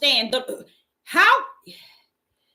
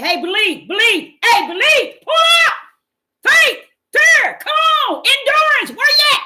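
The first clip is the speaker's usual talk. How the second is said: high, loud shouting call to believers; Faith calling out; 11 semitones higher, 7 dB louder than usual. Acted. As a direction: shout it out like Faith rallying the crowd.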